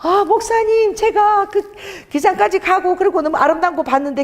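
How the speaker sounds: put-on high-pitched, earnest childlike voice; childlike, innocent evangelist. Evangelist phoning the pastor about God's plan, childlike and earnest. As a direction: imitate an innocent, moved caller gushing. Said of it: "애기처럼 순수한 분인데".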